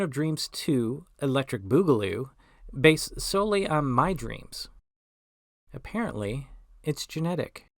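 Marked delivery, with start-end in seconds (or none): none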